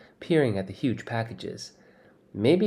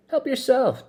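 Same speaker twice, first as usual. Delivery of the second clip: much higher, louder, talking through food; mouth full; 5 dB louder than usual. Acted. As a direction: offer it with your mouth full. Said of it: "his mouth full".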